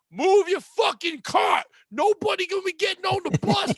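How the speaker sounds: high, loud, drawn-out bus-passenger yell; retold yelling. Woman on the bus to another about her cart, imitated yelling. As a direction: imitate an angry passenger hollering across the bus. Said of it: "They're just over my head yelling".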